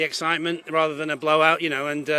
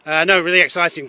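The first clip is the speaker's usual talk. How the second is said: usual speaking voice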